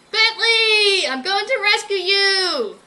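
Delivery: high, loud call; crying out. Acted. Berkeley calling to his lost friend, loud high cry. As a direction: call out as if he could hear.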